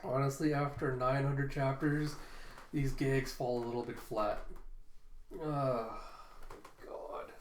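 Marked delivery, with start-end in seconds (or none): none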